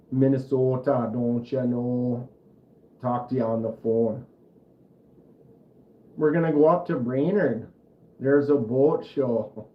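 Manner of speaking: hammed-up Minnesota accent, long round vowels. Shown as a demonstration. Streamer showing viewers a home-state Minnesota accent. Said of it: "it's a Minnesota accent".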